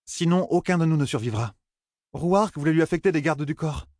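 mildly patient, high character voice; understated patience in a high voice. A narrator's reading. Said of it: "elle ajouta d'un ton patient".

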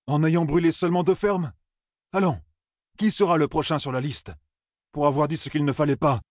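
high-pitched, pointed challenge; Perrine, challenging. Acted.

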